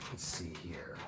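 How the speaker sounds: whispered to himself. Real, not acted. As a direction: whisper while thinking it over. Thinking aloud.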